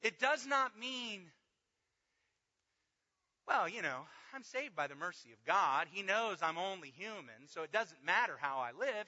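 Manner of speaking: loud, high, driving sermon; full-force preaching. Acted. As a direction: preach it out hard to the room.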